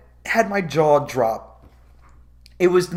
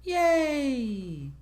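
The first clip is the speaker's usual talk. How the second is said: mock sarcastic cheer; 10 semitones higher, 5 dB quieter, slower than usual. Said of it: "And everyone's like".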